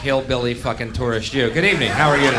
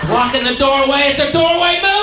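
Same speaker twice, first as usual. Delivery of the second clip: shrieked high and loud, mocking a tourist; mock tourist shout; 12 semitones higher, 8 dB louder than usual. Mocking the tourists he hates, high shriek. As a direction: yell it like a gawping tourist.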